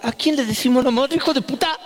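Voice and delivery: high-pitched indignant retort; mock outrage. Mother offended by her son's insult, high outraged retort.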